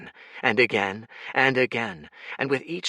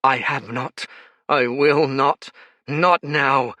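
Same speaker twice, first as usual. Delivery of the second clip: louder, higher stubborn refusal; defiant; 4 semitones higher, 6 dB louder, slower than usual. Acted. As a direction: refuse through the anger. Jez refusing defeat.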